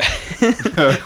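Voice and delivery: a sudden high laugh mid-sentence; giggling at own story; burst of laughter. Real, not acted. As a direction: laugh out loud at the memory. Laughing while telling the bag story.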